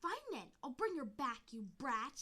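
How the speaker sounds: loud, irritated retort; angry snap. Acted. Deputy snapping at accusing kits, loud irritated retort. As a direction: throw it back at them in anger.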